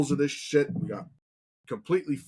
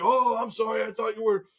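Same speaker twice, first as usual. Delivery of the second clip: higher, embarrassed apology; higher sheepish excuse; 8 semitones higher than usual. Retold or imitated. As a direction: back down and explain yourself. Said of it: "he's like".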